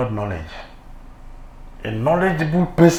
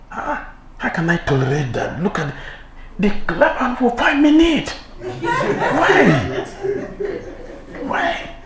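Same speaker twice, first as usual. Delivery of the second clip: much higher, laughing envious complaint; jealous whine; 12 semitones higher, slower than usual. Acted. Jealous devotee complains about applause, high and laughing. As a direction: squeal out the resentment.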